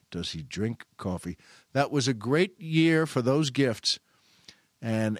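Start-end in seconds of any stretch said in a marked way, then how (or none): 0.1-1.4 low, soft, reflective; musing to himself. Retold or imitated. Storyteller marvelling at the guy's question, quiet musing. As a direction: say it softly, as a private thought. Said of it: "And I thought".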